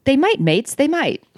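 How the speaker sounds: high, wobbly attempt at British; failed British accent. A narrator's reading. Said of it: "I couldn't even do that in a British accent".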